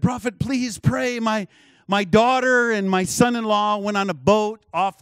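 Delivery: high, urgent retelling of a plea; imitated distraught plea. Desperate mother phoning a prophet, imitated pleading. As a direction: beg for prayer, without real sobs. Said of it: "hear her screaming and crying".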